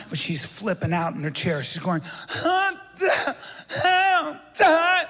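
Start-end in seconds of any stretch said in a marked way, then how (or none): 2.4-5.1 a sick passenger freaking out; panicked scream. Retold or imitated. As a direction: shriek it in sudden panic. Passenger in medical distress.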